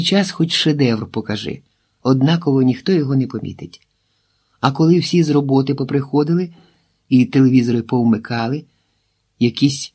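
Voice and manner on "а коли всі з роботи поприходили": ordinary delivery, nothing marked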